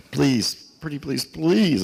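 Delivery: repeated high, coaxing pleas; retold pleading. Stranded traveler talking a taxi driver round, pleading.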